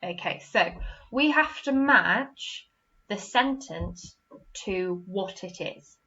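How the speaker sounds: ordinary talk, nothing marked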